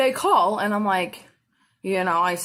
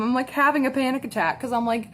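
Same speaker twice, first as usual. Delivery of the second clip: ordinary talk, nothing marked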